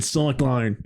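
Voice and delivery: drawn-out Australian 'cyclone', like 'sock-line'; slow, exaggerated Australian mimicry; mock Australian accent. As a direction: tease with an overdone Australian accent. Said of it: "did not sound Australian".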